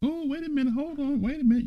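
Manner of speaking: exaggerated double take; mock surprise. Real, not acted. Teacher catching a surprising word, high mock surprise. Finds Scottish ancestry answer.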